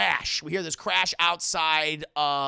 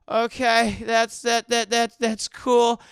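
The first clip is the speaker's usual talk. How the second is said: louder, stammering forced calm; nervous agreement; 4 semitones higher, 6 dB louder, slower than usual. Retold or imitated. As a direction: stutter through it, trying to sound fine. Michelle nervously humouring captor.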